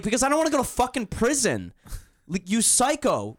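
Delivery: high-pitched indignant retort; indignant outburst. Retold or imitated. Answering the girlfriend in the retold quarrel, indignant outburst.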